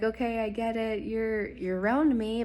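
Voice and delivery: rising excitement, no joke; excited and loud. Real, not acted. Second 444 plate, thrilled.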